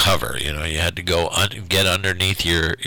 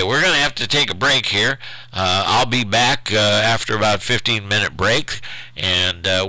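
ordinary talk, nothing marked

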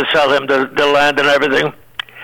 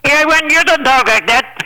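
Hervé voice; 9 semitones higher than usual. Retold or imitated. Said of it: "retold Hervé reply".

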